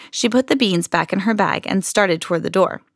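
ordinary delivery, nothing marked

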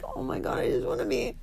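hushed, whispery mock panic; tongue-tied plea. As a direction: whisper it as a desperate aside. Acted tongue-tied inner plea.

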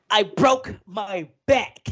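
slow, drawn-out comic impression; slow boxer impression, played for laughs; Mike Tyson. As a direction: stretch each word in a mock celebrity voice. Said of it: "So, like Mike Tyson".

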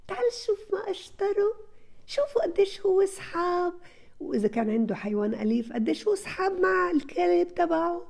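high, bright-eyed praising voice, kept high to the end; enthusiastic praise. Shown as a demonstration. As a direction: gush over the child in front of the others. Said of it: "هون أنت عم تمدحيه". (مديح متحمس بصوت عالي النبرة)

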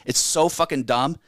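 ordinary talk

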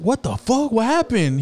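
high surprised outburst; mock shock. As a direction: jump up in pitch like the friends hearing bad news. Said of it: "They're like".

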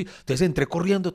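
ordinary talk, nothing marked

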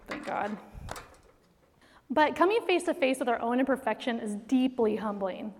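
ordinary talk, nothing marked

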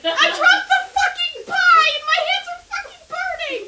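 pained yell. Acted. Character burning hands, yelling.

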